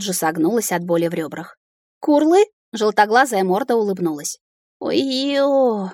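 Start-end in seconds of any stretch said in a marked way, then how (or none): 2.0-2.5 high, rising creature chirp; questioning dragon. A narrator's reading. Yellow-eyed dragon greeting her, high questioning call. 4.8-5.9 higher, long-stretched exclamation; drawn out. A narrator's reading. Heroine seeing the dragon's toothy grin, drawling in dismay. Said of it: "протянула я".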